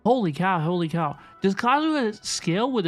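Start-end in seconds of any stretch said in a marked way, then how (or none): none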